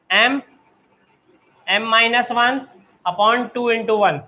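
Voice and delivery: each term drawn out slowly for copying; deliberate classroom dictation pace. Shown as a demonstration. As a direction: dictate it slowly so students can write. Teacher dictating formula slowly.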